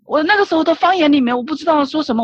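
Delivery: normal talking voice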